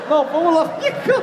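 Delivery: high laughing disbelief. Real, not acted. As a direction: crack up, voice up high. Laughing at hypnotized man. (rindo alto e agudo)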